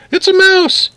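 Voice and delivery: very high, mock-terrified shriek; yelled alarm. A narrator's reading. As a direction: imitate a woman shrieking at a mouse. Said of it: "yelled Missus Newcomb".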